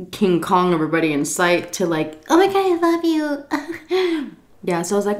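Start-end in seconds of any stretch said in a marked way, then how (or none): 2.3-4.3 high, slow, drawn-out gush; mock drunken lovey-dovey; sudden sloppy love, pitched way up; gushing drunk affection. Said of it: "your mood switches like that when you're drunk".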